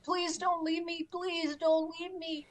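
high, pleading retold beg; begging them not to leave, close to tears; near tears. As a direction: plead tearfully, voice pitched high. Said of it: "I was almost in tears".

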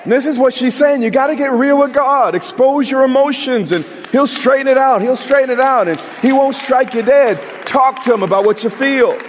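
high, loud, driving sermon voice; rising fervent preacher's call; full-force preaching. Acted. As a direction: preach it out to the whole room.

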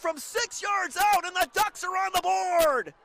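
rising to a loud excited shout; touchdown call. Real, not acted. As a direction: yell it as he scores.

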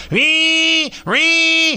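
high, loud sung ring ring like a telephone; mimicked phone ring. Host mimicking the studio phone ringing, high and loud.

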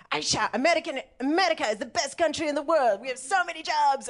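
high, fast boast in an imitated voice; super-patriotic relative. Imitating a patriotic relative on a phone call, boastful and high. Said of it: "super patriotic".

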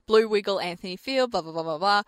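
ordinary talk

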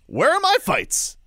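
high-pitched, aggrieved complaint; exasperated protest. Real, not acted. Complaining Arizona gets fights instead, exasperated high protest. Ranting at promoter over promised fights.

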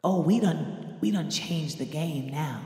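low, soft, slow taunt; quiet threatening taunt; quiet menace. Retold or imitated. Arya's quiet threat imitated.

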